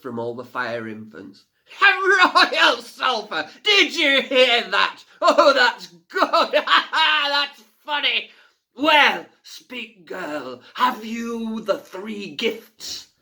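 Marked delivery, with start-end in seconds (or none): 1.8-8.3 loud, high, slow words through laughter; mocking laughter. A narrator's reading. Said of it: "laughed even harder". 8.7-13.1 loud, high, slow scornful boom; mocking fire fiend. A narrator's reading.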